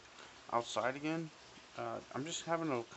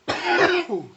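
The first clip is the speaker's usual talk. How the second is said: strained yelp; 14 dB louder than usual. Real, not acted. Strained, then coughs.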